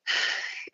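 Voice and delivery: long breathy sigh; heavy sigh. Retold or imitated. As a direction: let out a sigh mid-recollection. Pause inside retold tearful account.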